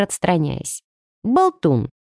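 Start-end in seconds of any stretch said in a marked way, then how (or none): 1.2-1.9 huffy snort. A narrator's reading. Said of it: "фыркнула я".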